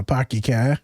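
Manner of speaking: slow mock Boston accent; put-on Boston accent. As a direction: imitate Boston speech, drawn out. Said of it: "What a lovely accent".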